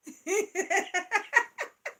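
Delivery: high bursting laugh; hearty laugh breaking out; loud laugh. Real, not acted.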